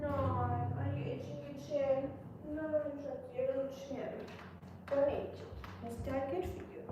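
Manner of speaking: soft, high cooing to a pet. Real, not acted. Cooing at itchy dog.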